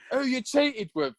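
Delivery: high, slow question through laughter; laughing mock accusation. Boyfriend retelling his wrong guess about cheating, laughing. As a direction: replay the accusation while cracking up.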